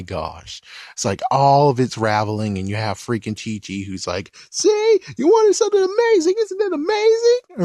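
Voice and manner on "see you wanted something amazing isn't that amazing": very high squeaky falsetto mom voice; high falsetto of a proud mom; Chi-Chi impression. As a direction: gush in a shrill-high mother's falsetto.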